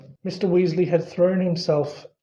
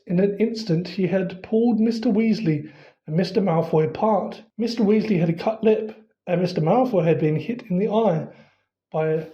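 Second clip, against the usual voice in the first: ordinary delivery, nothing marked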